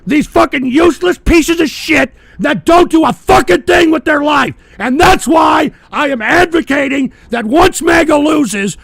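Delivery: loud, high, raging yell; screaming outrage at the freeloaders he showed; furious shout. Real, not acted. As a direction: tear into them at full volume. Furious rant at welfare recipients.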